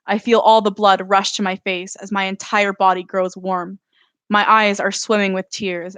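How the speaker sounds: usual speaking voice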